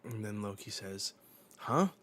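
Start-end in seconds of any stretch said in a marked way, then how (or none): 1.6-1.9 surprised. Acted. Loki's startled reaction.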